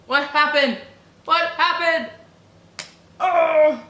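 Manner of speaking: loud, high cries of disbelief; shocked outcry. Real, not acted. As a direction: shout in shock, building to a wail. Shocked outburst at plot twist.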